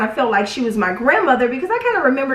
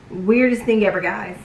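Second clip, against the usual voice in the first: ordinary talk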